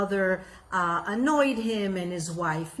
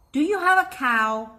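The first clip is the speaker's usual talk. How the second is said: teasing question; 6 semitones higher, 5 dB louder than usual. Acted. Rabbi asks mischievously.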